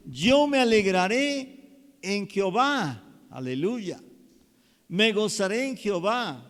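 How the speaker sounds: loud, ringing sermon voice; full-force preaching. Acted. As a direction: preach it out with fire. Preaching climax, rising.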